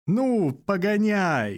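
high, slow rattling hunchback's cry. A narrator's reading. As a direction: rattle "Ну, погоняй!" high and drawn out. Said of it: "дребезжит горбач".

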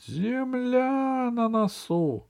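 high, long-held lookout's call; repeated cry. A narrator's reading. Fuks calls out land to the captain, crying out. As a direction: sing out high and slow, without real volume. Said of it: "А Фукс опять кричит".

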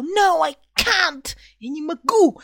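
high, loud anguished cry of refusal; dramatic man's outburst. Retold or imitated. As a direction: wail it out like a torn lover. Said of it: "и потом он такой сказал".

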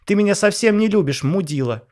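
high, loud accusing shriek; shrieking wife. Acted. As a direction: yell it at your husband. Wife yells at husband.

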